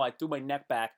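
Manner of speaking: ordinary delivery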